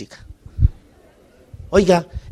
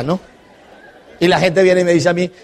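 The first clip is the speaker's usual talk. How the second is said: ordinary talk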